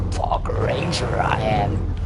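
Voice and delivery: lightly voiced ranger. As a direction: state it like a proud ranger. Role voice as ranger.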